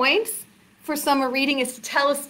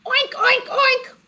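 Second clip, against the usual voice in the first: high, short oinks; pitched-up piggy voice; pig oinking; 12 semitones higher than usual. Acted. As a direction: oink like a pig. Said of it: "George opens his mouth and says".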